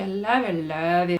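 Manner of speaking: mock-swooning, gushing I love you; hammed-up lovesick gush. Giselle gushing his love to R D in a hammed-up swoon. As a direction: overdo the repeated I love you like a besotted lover. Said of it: "He, like, confesses his love".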